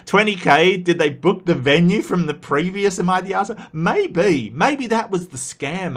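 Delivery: light up at a juicy theory; excited reaction. Real, not acted.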